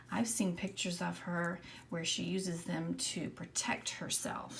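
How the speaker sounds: ordinary talk, nothing marked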